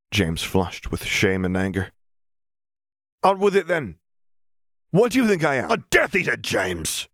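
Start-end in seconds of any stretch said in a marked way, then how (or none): 3.2-7.1 shouting. A narrator's reading. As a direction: hurl the question as a challenge. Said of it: "he shouted".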